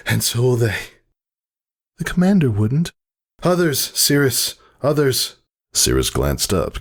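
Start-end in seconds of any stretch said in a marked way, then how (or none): 0.1-5.4 pony character voice. Acted. Pony characters arguing during birth.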